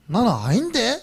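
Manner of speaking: 갈릴리 억양으로 높게 "아닌데?"; high-pitched denial in a Galilean accent. Retold or imitated. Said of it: "이 말이 억양에 들켜버렸어". (갈릴리 사투리 억양으로 부인하는 말투)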